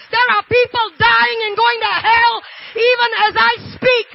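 loud, high, shouting sermon climax; fiery preacher crying out to the congregation; full-force preaching. Acted. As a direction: preach it out at the top of your voice, pleading.

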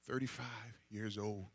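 ordinary talk, nothing marked

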